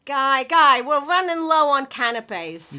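loud, urgent call to husband. Acted. Calls husband from across the house.

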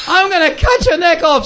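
very high-pitched acted threat; mock furious threat. Missionary chasing his dog with a machete, mock fury. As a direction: act out raging at the dog mid-chase. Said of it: "I chase him with my machete".

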